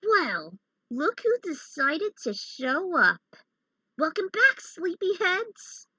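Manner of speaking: villain taunting the heroes who just woke up; smug villain greeting. Acted. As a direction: sneer a cocky welcome back. Character greets awakened foes.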